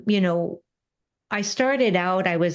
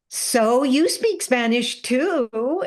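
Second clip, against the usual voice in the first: slow retort; 5 semitones higher, much slower than usual. Retold or imitated. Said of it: "And I go".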